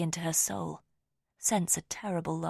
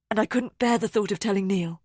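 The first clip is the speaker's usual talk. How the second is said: louder, anguished; distressed; 3 semitones higher, 6 dB louder than usual. A narrator's reading. Rosamond tells narrator her anguish, louder. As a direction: let the anguish spill out.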